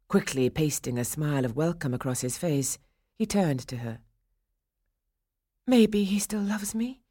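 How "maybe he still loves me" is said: high, half-whispered inner voice; hopeful thought. A narrator's reading. Swanhilda hoping to herself, in a high half-whisper. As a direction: think it aloud, lifted and airy. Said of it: "thought Swanhilda".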